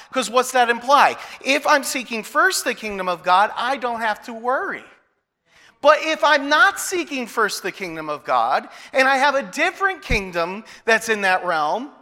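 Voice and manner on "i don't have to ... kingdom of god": usual speaking voice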